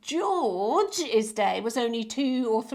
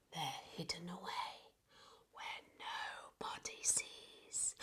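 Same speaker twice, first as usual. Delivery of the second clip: a secret whisper, softer and breathless; 6 semitones lower, 21 dB quieter than usual. Acted. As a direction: hush it like telling a secret. Secretive line of the rhyme.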